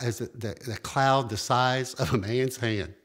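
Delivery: ordinary talk, nothing marked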